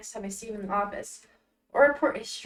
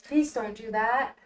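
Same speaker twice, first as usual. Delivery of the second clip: usual speaking voice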